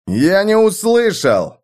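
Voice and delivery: high, slow, forceful bark; barked demand. A narrator's reading. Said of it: "рявкнул Рихард".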